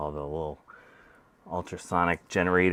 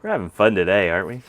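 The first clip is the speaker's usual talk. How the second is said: sarcastic; 2 semitones higher, 9 dB louder, faster than usual. Real, not acted. Frustrated repair, ironic remark.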